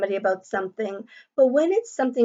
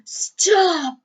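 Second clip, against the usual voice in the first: Lucy crying out, high and loud; upset cry; 7 semitones higher, 3 dB louder than usual. Acted. Lucy yelling at the bully Ralph to stop. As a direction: shout it to make him stop.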